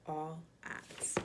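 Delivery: all odds whispered; whispered ending. Acted. Reading the dedication.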